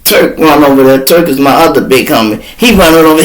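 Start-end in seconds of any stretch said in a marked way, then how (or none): none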